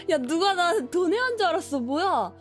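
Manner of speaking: jolted and exclaiming loudly; startled loud outburst. Real, not acted. Startled by game voice reader.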